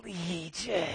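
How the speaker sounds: creepy demon voice. Pretend. Preacher voicing the demon naming itself to Jesus, creepy and hushed. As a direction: breathe the name like an unseen demon. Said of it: "this is a good one, you know, kind of a".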